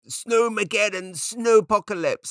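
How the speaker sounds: high, mock-dramatic doom announcement, slowed at the end; a mock newsreader hyping a storm; hammed-up headline.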